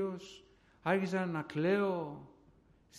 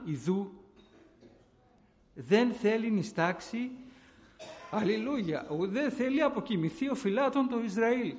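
louder fervent preaching to the church; preaching at full force; 4 semitones higher, 6 dB louder than usual. Acted. Preacher testifying to the congregation, fervent and loud. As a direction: preach it with rising fervour.

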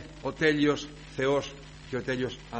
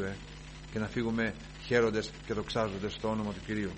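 ordinary talk

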